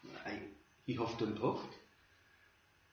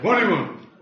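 louder, blunt question; gruff demand; 6 semitones higher, 6 dB louder than usual. Retold or imitated. Preacher imitating the grumpy doorstep man, blunt demand. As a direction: throw it back like the grumpy man. Rude man answering the door.

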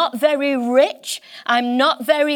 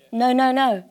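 ordinary talk

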